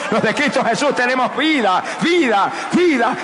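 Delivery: rising, shouted cries of vida; full-force preaching. Acted. Preacher crying out life to the congregation, shouting.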